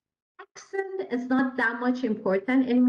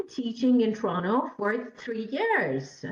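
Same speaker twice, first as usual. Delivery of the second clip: "three years" lifted, then falling; stress on three years. Shown as a demonstration. Teacher answering how long, main stress on "three years". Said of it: "So the stress is on".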